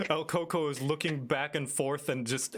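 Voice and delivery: normal talking voice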